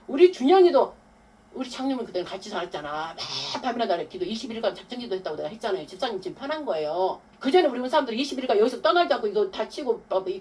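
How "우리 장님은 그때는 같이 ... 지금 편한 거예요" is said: normal talking voice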